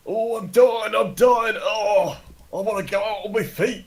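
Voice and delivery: loud, high death wail; hammed-up dying. Player voices a dying character getting up, hammed-up dying wail. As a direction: cry out dramatically as if dying.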